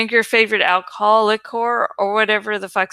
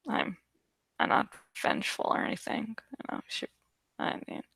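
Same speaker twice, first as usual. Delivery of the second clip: mock-innocent mutter; 14 dB quieter, faster than usual. Mock denial about shippers.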